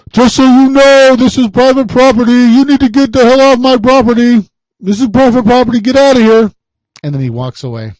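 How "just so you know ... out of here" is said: much higher, louder furious shouting at the intruder; angry yell. Retold or imitated. Furious business worker shouting at the private investigator through the car window. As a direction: bark it like an enraged property owner ordering him off. Staff guy yells at parked PI.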